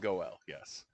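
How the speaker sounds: "Goel" said as two syllables, go-EL; correct pronunciation. Shown as a demonstration. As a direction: say "Goel" slowly as go-el. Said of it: "glad to hear you pronounce that".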